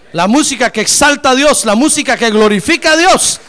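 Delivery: high, very loud shouted exaltation; rousing pulpit shout, praising God; full-force preaching. Real, not acted. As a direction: preach it at the top of your voice.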